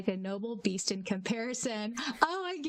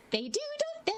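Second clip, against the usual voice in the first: much higher put-on character voice; Tomeo voice; faster than usual. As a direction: pitch way up for the character. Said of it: "Any excuse I have to do a Tomeo voice".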